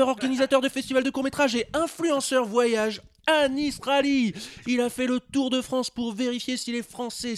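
bright, high presenter voice; radio host intro. Acted. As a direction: announce the guest like a show host.

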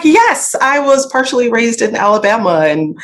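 bright high proud voice; high, happy, proud reply; proud and excited. Retold or imitated. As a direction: beam with pride about Alabama. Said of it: "And I'm like".

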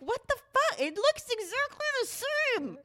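hammed-up wife unimpressed by the new TV; mock exasperated squeak. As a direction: squeak it out in disbelief at the TV. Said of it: "I'm like".

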